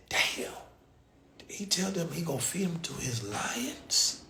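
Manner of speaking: whispering in disbelief; hushed shocked aside; stunned whisper. Retold or imitated. Narrator reacts to the lion threat, hushed whisper.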